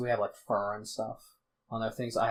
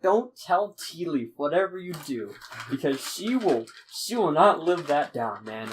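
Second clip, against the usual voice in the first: urgent warning; 5 semitones higher, 5 dB louder than usual. Acted. Acted urgent warning from the lady.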